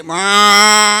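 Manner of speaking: loud imitated cow-horn moo. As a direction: honk it like a truck horn that moos. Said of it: "trae un claxon de vaca".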